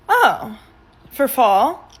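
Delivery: ordinary delivery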